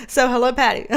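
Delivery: usual speaking voice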